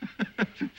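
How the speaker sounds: short breathy scoffing chuckle; a dismissive little laugh under the breath; quick amused scoff. Acted. Boss scoffs at John Henry.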